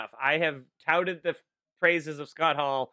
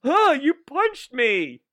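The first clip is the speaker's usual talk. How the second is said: mock surprise; 8 semitones higher, slower than usual. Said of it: "they're like".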